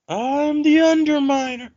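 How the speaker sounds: slow, high cartoon-villain boast; slow and high, like a cartoon villain announcing himself; villain announcement. Joking villain intro.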